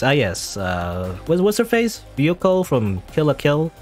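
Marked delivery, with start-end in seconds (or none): none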